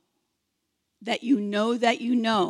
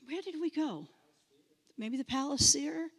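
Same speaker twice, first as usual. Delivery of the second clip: ordinary talk, nothing marked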